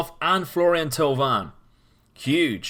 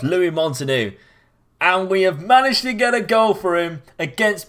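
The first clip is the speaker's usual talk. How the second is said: excited; 6 semitones higher, 4 dB louder than usual. Real, not acted. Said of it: "getting this excited".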